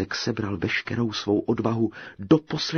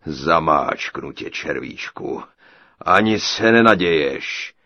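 slow, low giant rising to a boom; threatening giant; 5 semitones lower, 7 dB louder than usual. A narrator's reading. Giant threatens to squash the tailor, booming menace. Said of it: "prohlásil obr".

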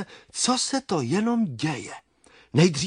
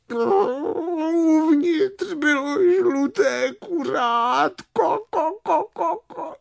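very high, drawn-out clucking wail; tearful hen peep; 13 semitones higher, slower than usual. Acted. Hen revealing the yellow chick inside, tearful peeping. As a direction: squeak it out, trailing into clucks. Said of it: "špitla slepice".

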